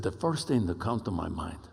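low imitation of a Spanish-accented man; Pablo's deep Spanish accent. Ambassador telling the men what he would miss, imitated deep Spanish accent. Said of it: "deep Spanish accented voice like this".

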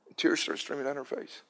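ordinary talk, nothing marked

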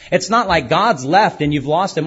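ordinary delivery

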